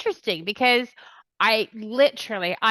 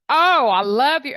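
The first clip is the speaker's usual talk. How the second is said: high, loud fan squeal of delight; mock gushing; 6 semitones higher, 7 dB louder than usual. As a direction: gush like a smitten stranger, hammed up. Mimics strangers gushing at her husband.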